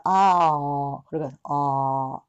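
あー held as an open back vowel. Shown as a demonstration. Teacher modelling the open o vowel. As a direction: hold あー as the reversed c sound. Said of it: "Cの逆さまです".

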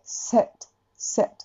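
セット from the mouth, quiet; no nasal セット; oral set. Shown as a demonstration. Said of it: "口で".

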